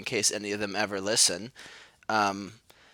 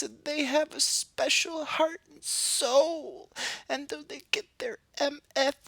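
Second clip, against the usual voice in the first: high, sneering parody voice; mocking voice. Ridiculing rich kids who want art careers, high mocking voice. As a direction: lampoon the art kids in a much higher squeaky voice.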